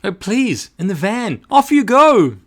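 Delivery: high, pleading, pushing him out the door; imploring. A narrator's reading. Boss urges the new van man off, high and pleading. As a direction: beg him, rising to a loud send-off. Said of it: "Then she implored him".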